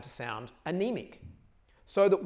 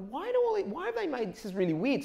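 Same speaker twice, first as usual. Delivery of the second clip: puzzled; 10 semitones higher than usual. Retold or imitated. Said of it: "I'm like".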